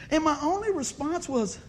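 ordinary talk, nothing marked

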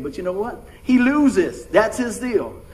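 ordinary talk

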